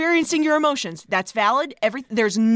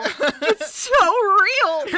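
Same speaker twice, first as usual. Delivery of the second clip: mock awe; 5 semitones higher, much slower than usual.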